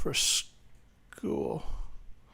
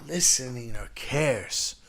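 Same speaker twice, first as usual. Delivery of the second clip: usual speaking voice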